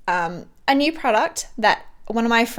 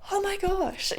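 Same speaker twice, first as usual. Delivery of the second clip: moved to tears; 10 semitones higher, 6 dB quieter than usual. Retold or imitated. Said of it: "And I was like".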